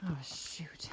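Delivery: soft whispered oh shoot; whispered dismay. Real, not acted. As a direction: breathe it out, let down. Dismay at short distance.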